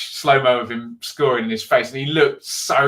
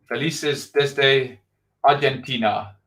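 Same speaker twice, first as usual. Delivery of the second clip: put-on slow, hesitant stab at an Argentine Spanish accent; halting Argentine Spanish accent attempt; slower than usual. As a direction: try the Argentine accent and half fail. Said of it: "I can't do the accent. I'm trying".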